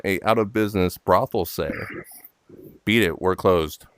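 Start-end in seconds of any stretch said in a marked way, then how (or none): none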